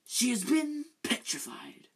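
high whispered pronouncement; hushed Dumbledore. Acted. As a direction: breathe it out as grave news. Dumbledore voiced in story.

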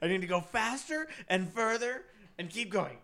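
high, driven dog's voice; imitated eager dog. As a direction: play a dog straining to keep going. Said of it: "he's like".

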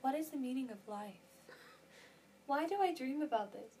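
hushed quote before the big outburst; mock pondering. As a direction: muse it quietly, as if in a musical. Quoting show's big questions.

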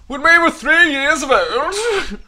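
very high, very slow sing-song voice; silly put-on voice. Joking to her sister about sharing a hay pile, high slow silly voice. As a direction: drag it out like a daft old storyteller. Silly voice reciting childhood line.

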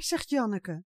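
plain reading voice

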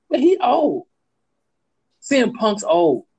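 high, loud sudden realization; the penny drops, voice jumps up; surprised realization. Real, not acted. Realizing Punk is old.

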